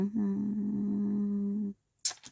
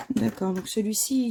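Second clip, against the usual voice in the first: ordinary talk, nothing marked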